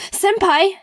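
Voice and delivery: loud high squeal of senpai; excited squeal. Retold or imitated. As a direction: squeal it like a thrilled fan. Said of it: "And I was like".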